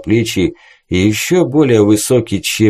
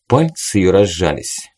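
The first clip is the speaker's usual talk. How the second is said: ordinary delivery, nothing marked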